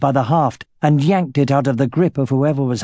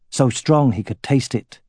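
usual speaking voice

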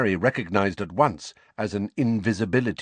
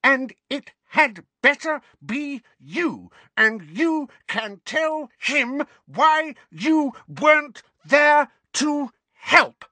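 high, slow, word-by-word shouting; furious yell; 5 dB louder, slower than usual. A narrator's reading. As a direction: punch out each word with a blow. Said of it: "yelled Missus Figg".

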